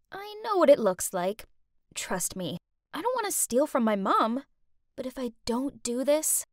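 usual speaking voice

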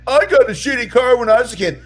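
mocking whiner. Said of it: "So anybody said".

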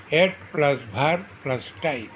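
"অ্যাড প্লাস ভার প্লাস টাইপ" in three spaced beats; syllable breakdown. Shown as a demonstration. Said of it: "যে ওয়ার্ডে তিনটি syllable থাকে".

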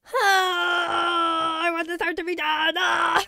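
loud, high shout of frustration; frustrated yell. Real, not acted. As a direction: let out a real fed-up scream about the art. Frustrated yell about unfinished art.

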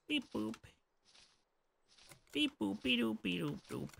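high, soft beep-boop noises; robot beeps. Pretend. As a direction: chirp it like a little robot.

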